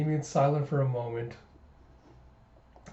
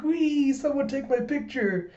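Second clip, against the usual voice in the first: very high gleeful squeal; giddy, put-on childlike delight; sparkly happy; 11 semitones higher, slower than usual. George shows off the borrowed star, sparkly happy. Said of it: "sparkle happy".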